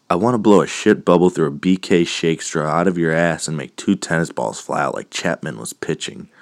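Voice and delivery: rattled off as one big sentence; quick, breathless-style run-on read; no pause. Shown as a demonstration. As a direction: run it together fast, no punctuation. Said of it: "It's more, no pause".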